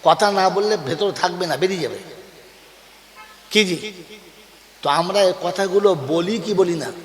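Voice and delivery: normal talking voice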